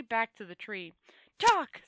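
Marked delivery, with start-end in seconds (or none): 1.4-1.8 sharp loud shout of one word; scream. A narrator's reading. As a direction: bark the order at him. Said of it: "screamed Rince-, Rincewind".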